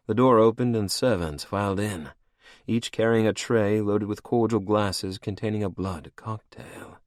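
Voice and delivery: ordinary delivery, nothing marked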